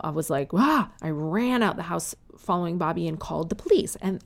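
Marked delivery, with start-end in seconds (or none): 0.5-0.9 short high mock scream; a yelped "Ah!" of fright; retold scream. Wife discovering the burglar, a short high yelp. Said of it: "I freaked out".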